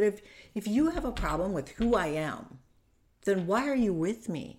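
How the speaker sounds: high, loud retold confrontation; exasperated challenge. Woman retelling how she confronted her partner, exasperated. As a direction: throw the question at him, exasperated.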